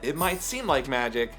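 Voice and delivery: ordinary delivery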